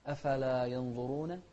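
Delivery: usual speaking voice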